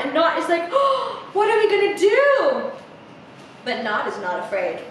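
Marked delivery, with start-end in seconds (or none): 0.7-2.7 high, loud gasping wail; gasp, then cry out in storybook panic; hammed-up frightened squeal; panicked Not. A narrator's reading. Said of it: "Snake is super scared".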